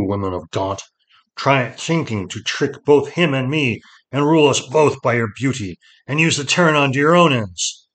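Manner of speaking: loud scorn building in pitch; wrathful lord passing sentence; angry accusation. A narrator's reading. Lord rages at disloyal wife.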